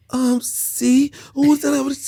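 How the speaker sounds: high woman babbling mock Spanish; high-pitched fake Spanish chatter. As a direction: imitate her rattling off high-pitched Spanish. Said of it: "And she like".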